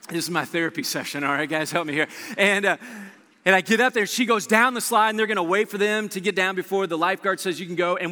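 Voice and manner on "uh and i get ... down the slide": usual speaking voice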